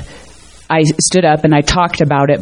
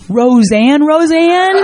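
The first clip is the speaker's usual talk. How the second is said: rising much higher in amazement; the penny drops, Roseanne?; realizing the founder is handing her the candle, stunned disbelief; 9 semitones higher, much slower than usual. Retold or imitated. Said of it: "And I'm like".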